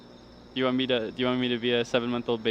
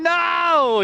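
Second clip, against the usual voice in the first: high, loud, drawn-out protest; indignant cry; 10 dB louder than usual. Real, not acted. Denying the caricature is accurate, high indignant cry. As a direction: wail the word in outrage. Mock protest at being teased.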